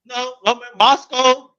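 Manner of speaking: high, loud and slow, playing dumb; mock clueless voice. Host mocks people who can't name Russian places, playing dumb. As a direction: drag it out like someone who knows nothing about Russia.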